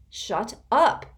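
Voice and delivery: loud, high snarl. A narrator's reading. Constance snarling at Rainey to shut up. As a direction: spit it out angrily. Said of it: "Constance snarled".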